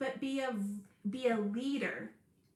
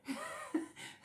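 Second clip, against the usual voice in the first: short high surprised laugh; high breathy laugh; 8 dB quieter than usual. Real, not acted. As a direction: let a quick laugh slip out. Laugh after surprised quote.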